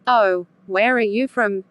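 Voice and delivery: normal talking voice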